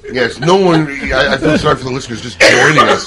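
talking through real laughter; laughing. Real, not acted. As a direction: crack up while trying to comment. Host cracks up over the accent.

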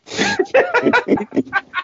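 very high, helpless laugh; real laughter at his own story; burst of laughter. Real, not acted. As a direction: crack up at the punchline. Punchline of the prank story.

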